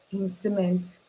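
usual speaking voice

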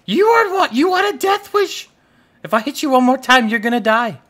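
high, loud hammed-up threats; mock-furious shouting. Player threatening the chicken with death, mock-furious shouting. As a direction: shout comic threats at a pest.

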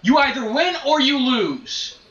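loud and high, fed up; exasperated shout. Real, not acted. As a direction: insist loudly that quitting is impossible. Gamer defending staying in match.

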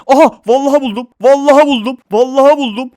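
high, loud thrilled yelling; excited shout. Real, not acted. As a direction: shout it with joy of discovery. Finds what he searched for.

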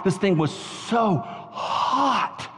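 very slow, stretched "so hot"; drawn-out emphasis. Retold or imitated. Preacher recounts the pepper's burn, slow drawn-out emphasis. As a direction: drag out each last word for dramatic effect.

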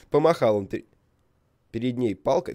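ordinary talk

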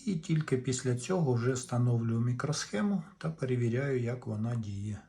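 ordinary talk

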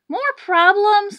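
slow, high, hammed-up moan; a weary conductor moaning. A narrator's reading. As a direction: groan it out in despair. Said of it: "the conductor moaned".

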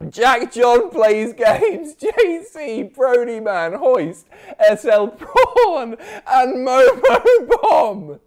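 slow, high and loud, laughing through each name; laughing while reading. Real, not acted. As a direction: push the names out between giggles. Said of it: "I'm, I'm crying".

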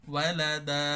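ওয়ালাদা ending on a long দা, no nun sound; pausal ending. Shown as a demonstration. Teacher shows waqf on ওয়ালাদা. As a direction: stop on ওয়ালাদা, stretch the final দা. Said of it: "দান না পড়ে দা".

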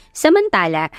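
normal talking voice

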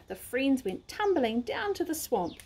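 ordinary delivery, nothing marked

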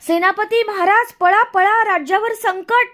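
high, loud cry of alarm; terrified shouting. A narrator's reading. Minister fleeing a charging bull, warning the king in terror. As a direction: shout a panicked warning as you flee. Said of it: "भेदरून जीव घेऊन".

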